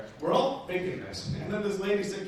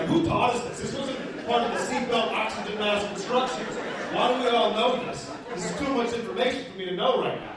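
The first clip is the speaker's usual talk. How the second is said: bewildered; 6 semitones higher, 5 dB louder than usual. Retold or imitated. Comic bewildered inner voice.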